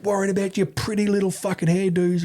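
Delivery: high-pitched mock scolding; impatient ordering. Ex-infantry mate scolding the handlers in rain, impatient. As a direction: rattle off exasperated orders, pitched well up.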